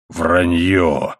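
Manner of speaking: low, menacing denial; growled denial. A narrator's reading. Husband denies his wife's charge, low and menacing. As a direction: drop low on it, as a warning.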